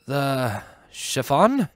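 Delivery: slow, hesitant question; guard, unsure. Acted. The guard guessing nervously, slow and hesitant. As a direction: guess carefully.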